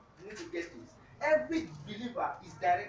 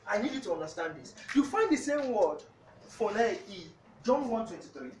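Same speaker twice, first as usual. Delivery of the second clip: ordinary talk, nothing marked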